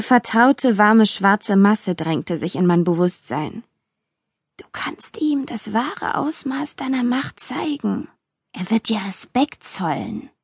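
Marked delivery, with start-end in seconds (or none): 4.6-10.3 slow, whispered inner voice; seductive whisper. A narrator's reading. Dark presence in her mind offering power, whispering. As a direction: whisper temptation, very softly.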